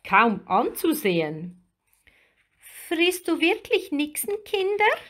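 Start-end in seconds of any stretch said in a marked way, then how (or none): 2.9-4.9 high, frightened little mermaid; anxious high-pitched question; trembling voice. A narrator's reading. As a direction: ask fearfully in a small, very high voice. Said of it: "fragte sie mit zitternder Stimme".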